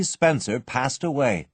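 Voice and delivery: ordinary delivery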